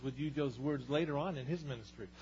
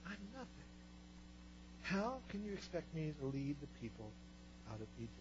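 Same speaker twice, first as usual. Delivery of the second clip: Moses' excuses, dropping softer and softer; timid pleading; 10 dB quieter than usual. Retold or imitated. Preacher voicing Moses pleading with God, small and fading. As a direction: shrink away as you plead, fading near silent.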